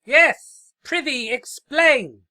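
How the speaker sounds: loud, high and slow royal boom; loud slow thundering proclamation. Acted. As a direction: declaim it like a grand alicorn. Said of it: "thundered Princess Sofa".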